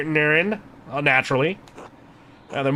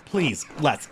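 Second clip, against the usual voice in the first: ordinary talk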